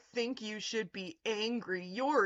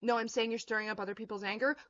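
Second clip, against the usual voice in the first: ordinary talk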